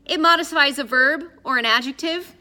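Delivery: high, loud explanation; helpful pedant. A narrator's reading. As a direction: explain the grammar point eagerly. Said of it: "Addison added helpfully".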